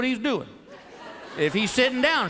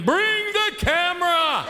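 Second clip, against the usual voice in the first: higher, slow, hammed-up shout; mock excited scream; 4 semitones higher, much slower than usual. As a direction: call out like you've made a monster. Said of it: "You're screaming".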